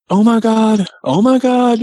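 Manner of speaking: very high imitated squeal of delight; mock excited scream. Daughter-in-law overjoyed at seeing a Bigfoot, mock excited scream. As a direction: squeal it like a thrilled onlooker, twice over. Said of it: "sitting there screaming".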